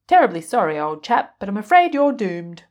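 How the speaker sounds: put-on a proper British accent delivering doom. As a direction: apologise in a British accent, very politely. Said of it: "A British fortune-telling washing machine".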